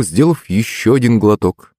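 ordinary delivery

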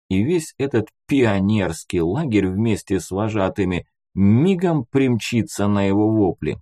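plain reading voice

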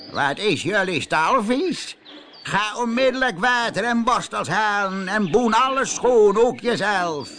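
high, loud, scolding farmer voice; nasty new farmer. Acted. New farmer Teun Kreun orders the pigs to scrub, loud and scolding. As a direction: bark orders at the dirty pigs.

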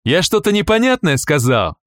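loud, threatening question; menacing threat. Acted. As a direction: menace him at gunpoint. Guard with drawn weapon.

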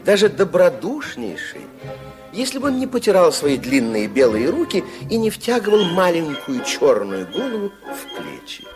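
normal talking voice

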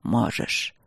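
a deep dragon murmur; calm certainty of a dragon lord; confident rumble. A narrator's reading. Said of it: "пророкотал он уверенно".

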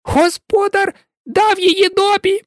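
very high squeaky elf voice, astonished; amazed house-elf. A narrator's reading. Dobby marveling at the sock from his master, amazed. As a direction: squeak out the wonder like a tiny elf.

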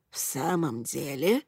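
put-on low, quiet doubting old lady; a sceptical old noblewoman; old woman voice. Hanshu surprised.

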